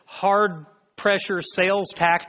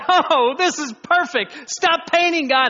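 much higher, louder "stop painting"; loud plea; 12 semitones higher, 5 dB louder than usual. Retold or imitated. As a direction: beg God to stop, delighted. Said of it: "you say".

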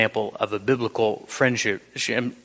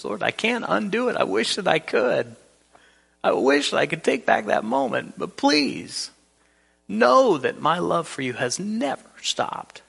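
higher, pleading, near tears; desperate plea; 8 semitones higher than usual. Acted. Peter pleading with Jesus to know his love, desperate. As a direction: beg him to believe you still love him. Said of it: "you can hear the desperation in Peter's voice".

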